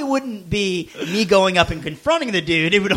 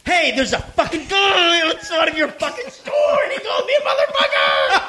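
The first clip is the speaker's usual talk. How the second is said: mock angry shout; 10 semitones higher than usual. Complaining to the store on the phone, laughing shout. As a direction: fake calm that boils over into yelling. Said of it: "trying to say it in the most cool way possible".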